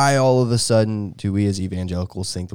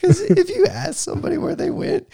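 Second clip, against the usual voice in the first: much higher, giggling through the words; laughing at seminary snobs. Real, not acted. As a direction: talk while cracking up.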